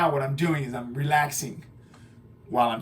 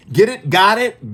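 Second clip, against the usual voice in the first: excited check; 7 semitones higher, 8 dB louder than usual. Real, not acted. Checking students understood.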